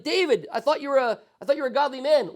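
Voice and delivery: high, loud dismay at David; disappointed outcry. Retold or imitated. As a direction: cry out, let down by a hero.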